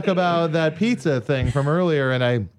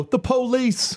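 imitating friend; 10 semitones higher, much slower than usual. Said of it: "He'd go".

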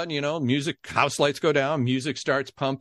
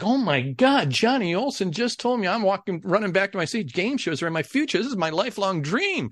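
high, thrilled inner voice; retold excitement; 6 semitones higher than usual. Kid running back to his seat, retold thrill. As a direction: gush it high, as a dream comes within reach.

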